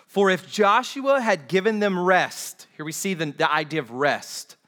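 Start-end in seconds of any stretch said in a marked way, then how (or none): 0.1-2.7 high, loud proclamation of the verse; projected, deliberate pulpit reading; slow scripture reading. Real, not acted. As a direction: read it out slowly to the whole church. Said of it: "I want to read these slowly".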